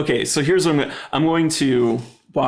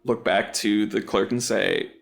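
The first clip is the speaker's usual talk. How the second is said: ordinary talk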